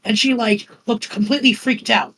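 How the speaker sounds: ordinary delivery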